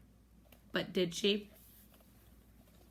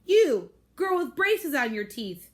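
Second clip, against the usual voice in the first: stern loud call-out; 6 semitones higher, 7 dB louder than usual. Acted. Missus V confronts Claire.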